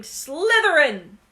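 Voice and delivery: slow, loud drawn-out scream. A narrator's reading. The hat screaming the house for Malfoy, loud and slow. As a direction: belt the house name out slowly. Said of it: "when it screamed".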